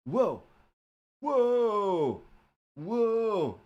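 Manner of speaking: loud high stretched-out whoa; a goofy dude's big whoa; surfer dude whoa. Pretend. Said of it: "drifting in more to Joey Tribbiani".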